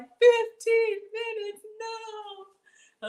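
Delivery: high, slow squeal of disbelief; hammed-up incredulous voice; mock disbelief. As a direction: squeak it out in fake outrage. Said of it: "And you're like".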